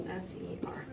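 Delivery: softly whispered letters; whispered spelling. Shown as a demonstration. Scene: teacher spelling the root to students, whispered.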